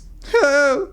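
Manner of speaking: a high whimpering cry, not a real laugh; complainy cry sound. Shown as a demonstration. Coach demos the whiny cry sound for students. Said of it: "a complainy cry sound".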